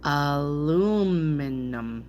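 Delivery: Aluminum drawn out very slowly; slowed down. Shown as a demonstration. Reader practising a hard word, Aluminum slowed right down. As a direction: stretch "Aluminum" carefully, long middle vowel. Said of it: "if I slow down".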